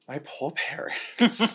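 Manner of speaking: slow, high, laughing wail; laughing mock lament. Thinking of parents hearing the podcast, laughs out a slow lament. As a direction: groan it through laughter. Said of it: "I'm like".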